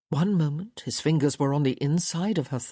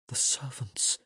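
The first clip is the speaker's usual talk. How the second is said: soft worried whisper; hushed whisper; 3 semitones lower, 5 dB quieter than usual. A narrator's reading. Woman fearing the servants hear, whispered. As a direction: whisper it, afraid of being heard. Worried about being overheard.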